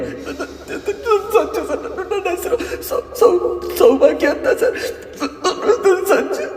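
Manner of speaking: desperate sobbing plea, breaking into cries. Acted. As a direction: beg through tears for her lost son. Crying mother at station. (ಬಿಕ್ಕಿ ಬಿಕ್ಕಿ ಅಳುತ್ತಾ ಬೇಡಿಕೆ)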